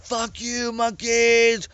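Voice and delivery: high, loud yell at the door. A narrator's reading. Captain yelling at the monkeys through the door, high and loud. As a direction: scream it while firing.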